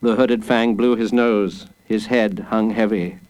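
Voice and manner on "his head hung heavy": usual speaking voice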